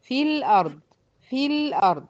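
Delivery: في الأرض recited as fil-ard, ي dropped, قاف-free smooth join; recitation demo. Shown as a demonstration. Teacher models the recitation for students, ي dropped. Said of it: "الأداء بقى هيكون إزاي؟".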